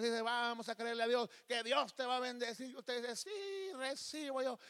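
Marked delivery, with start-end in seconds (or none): 0.2-2.7 lightly voiced rousing preacher, pitched up; offering minister. Church brother taking up the offering, mildly imitated exhortation. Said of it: "el hermano que está ministrando las ofrendas". 3.1-4.6 very high, drawn-out fervour; eager mock acceptance. Believer answering the offering preacher, exaggerated high fervour.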